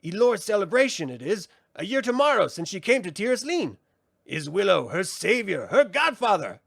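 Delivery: high, theatrical sprite voice; mocking brownie. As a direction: tease him with mock ceremony. Frangine needles Willow.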